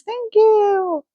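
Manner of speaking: lightly voiced high munchkin thanks. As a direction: chirp it high and small.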